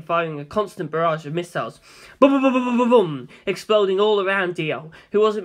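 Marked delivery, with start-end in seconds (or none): 2.2-3.3 booming explosions. A narrator's reading. Explosions voiced in narration.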